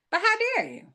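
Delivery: high, hammed-up "how dare you"; mock outrage. Vlogger scolding non-subscribers, hammed-up outrage. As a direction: pretend to be scandalized, playfully.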